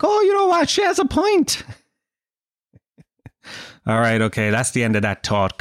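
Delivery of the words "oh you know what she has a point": very high, squeaky concession; mock realization. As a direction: jump into a falsetto as the point dawns. Acts out conceding to the cat.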